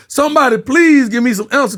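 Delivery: high, loud mock desperation; hammed-up high-pitched appeal; retold exasperated plea. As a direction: plead loudly to anyone listening. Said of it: "I'm looking around in the car like".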